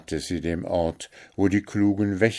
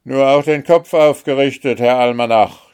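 loud, higher jeering shout; mocking shout; 7 semitones higher, 10 dB louder, faster than usual. A narrator's reading. Guard gripping Märchen's chin, loud mocking shout. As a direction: yell it in her face. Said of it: "schrie er".

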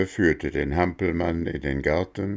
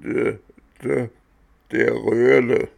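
stammered rattling last words; death rattle; 6 semitones higher, 6 dB louder than usual. A narrator's reading. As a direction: gasp it out while dying. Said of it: "röchelte in der gleichen Sprache".

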